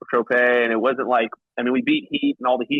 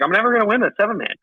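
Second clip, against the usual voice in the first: high-pitched, quicker self-quote of giving up; retold exasperation, pitched up and rushed; exasperated despair; 9 semitones higher, faster than usual. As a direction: act out being fed up with losing. Retelling his losing-streak despair.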